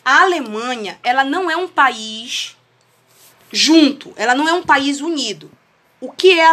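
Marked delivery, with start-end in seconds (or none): none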